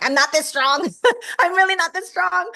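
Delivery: high, loud laughing denial; laughing protest. Real, not acted. To the interviewer, laughing that she isn't that strong.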